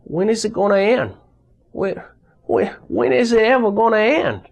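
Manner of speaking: mock-despairing soldier muttering to himself. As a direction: spiral into panic as you ask it. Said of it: "I just said over and over to myself".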